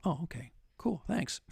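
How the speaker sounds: quietly acted letdown; deflated reply. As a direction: act out a small, let-down acknowledgement.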